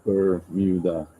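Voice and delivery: Bermuda drawn out slowly, syllable by syllable; deliberate pronunciation check. Shown as a demonstration. Vlogger doubting his own pronunciation, Bermuda said slowly and carefully. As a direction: say "Bermuda" slowly, testing each sound. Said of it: "Am I saying that wrong?".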